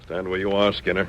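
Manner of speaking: stern voice. Acted. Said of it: "the stern voice of the Lone Ranger".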